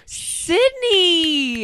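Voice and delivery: high loud thrilled shout of a name; excited cry. Real, not acted. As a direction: squeal the name with delight. Thrilled reaction to the poem.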